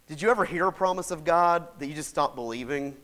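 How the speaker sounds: normal talking voice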